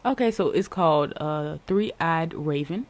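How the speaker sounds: ordinary talk, nothing marked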